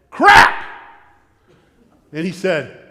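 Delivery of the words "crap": loud, high outburst of dismay; exclamation. Retold or imitated. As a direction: blurt it out much louder. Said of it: "And I said".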